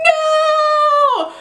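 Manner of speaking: very high wailing no; anguished no. Retold or imitated. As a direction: cry out as A looks back. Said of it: "they're all just like".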